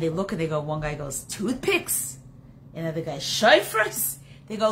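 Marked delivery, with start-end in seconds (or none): 1.2-2.2 puzzled, rising one-word query; mildly baffled by what's on offer; incredulous question. Acted. Friends react in disbelief to goods. 3.2-4.2 higher, rising voice of disbelief; baffled one-word query, pitched up; incredulous question. Acted. Second friend reacts in disbelief.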